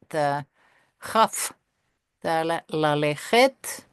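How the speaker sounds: normal talking voice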